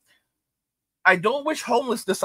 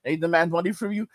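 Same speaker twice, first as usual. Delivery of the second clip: normal talking voice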